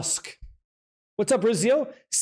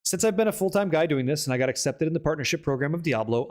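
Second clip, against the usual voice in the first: ordinary delivery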